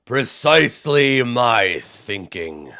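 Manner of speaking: big giant musing about the moonlight; genteel giant. Acted. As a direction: linger like a giant strolling in moonlight. Giant voice continues.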